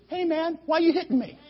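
high, soft talking donkey voice; a puzzled donkey asking why. Acted. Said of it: "The donkey turns around and says".

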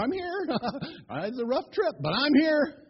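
high, giddy laughing reply; acted frazzled chuckling; laughing arrival. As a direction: announce yourself with a laugh, high. Balaam finally arrives after rough trip.

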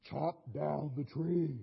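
slow, booming heavenly command. Retold or imitated. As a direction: speak it slowly like God from above. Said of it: "this voice from heaven saying".

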